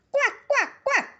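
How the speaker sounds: very high laughing quack; duckling quack. Acted. Ducklings quack as they reach the pond, very high. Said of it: "uttered two of the nine babies".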